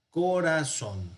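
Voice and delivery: "Corazón" slowly split as co-ra-zón; syllable by syllable. Shown as a demonstration. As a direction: draw "Corazón" out in three slow syllables. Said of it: "vamos a poder separar". ("Corazón" silabeado despacio: co-ra-zón)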